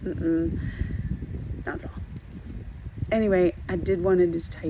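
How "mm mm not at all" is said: ordinary delivery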